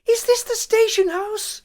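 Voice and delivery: high, slow, breathless panicked woman; a terrified woman bursting in for help; frantic woman. A narrator's reading. Frantic woman bursting into the station house, high and panicked. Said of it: "A frantic woman ran in with a scream".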